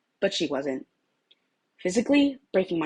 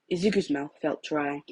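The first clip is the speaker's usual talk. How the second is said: ordinary delivery, nothing marked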